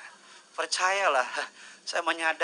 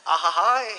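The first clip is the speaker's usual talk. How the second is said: playful whoop; 3 semitones higher, 11 dB louder than usual. Real, not acted. Playful exclamation after joke.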